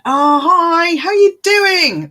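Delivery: high, loud, slow delighted hello; light up for a friend; excited greeting. Real, not acted.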